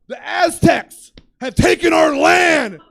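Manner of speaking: high, loud, slow hammed-up scream. Agitated friend ranting about Aztecs, screaming. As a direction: scream it as an agitated, drawn-out grievance. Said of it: "He starts screaming about how".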